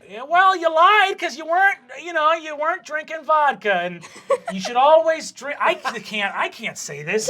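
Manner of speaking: high, blustering coach voice; flustered coach. Acted. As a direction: bluster through the scolding, then falter. Flustered coach invents an accusation.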